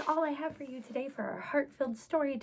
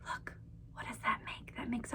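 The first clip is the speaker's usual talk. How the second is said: whisper; 8 dB quieter than usual. Acted. Hushed reveal to children.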